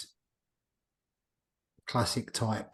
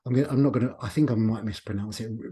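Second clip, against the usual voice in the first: normal talking voice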